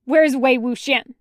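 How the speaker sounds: loud, curt demand; barking. Acted. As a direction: bark the question angrily. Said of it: "barks at Lan Suzhui".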